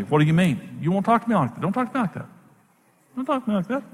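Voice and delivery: firmly refusing to be talked down to, lightly voiced; wife standing up for herself. Wife insisting on respect, lightly voiced firm protest. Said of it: "Karen began to stand up to me".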